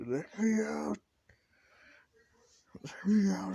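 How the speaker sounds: very high, loud desperate yell; pounding-on-the-door yell; yelling to be let out. Retold or imitated. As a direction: scream to be let out of the locked room. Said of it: "hears is yelling".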